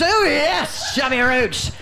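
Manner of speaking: high-pitched mock Dickensian voice; hammed-up old-time storybook figure; Dickensian character. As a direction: announce the name like a Dickens character. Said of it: "Don't they sound Dickensian?".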